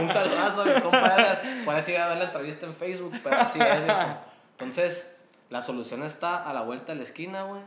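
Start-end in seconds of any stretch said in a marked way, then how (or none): 0.0-4.2 loud laughing greeting. Real, not acted. Greeting his buddy Edgar, laughing loudly. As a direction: shout it out through laughter.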